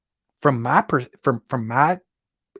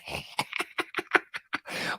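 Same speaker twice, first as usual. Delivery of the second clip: soft breathy laugh; 9 dB quieter than usual. Real, not acted. Laughing at own story.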